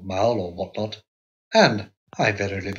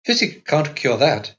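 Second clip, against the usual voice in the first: superior; 5 semitones higher, 4 dB louder, slower than usual. A narrator's reading. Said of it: "laughed Carlotta in a superior way".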